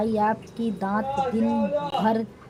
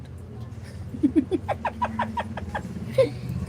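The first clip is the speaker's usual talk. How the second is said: breathless laugh; 11 semitones higher, 3 dB quieter than usual. Real, not acted. Laughing at her own teasing.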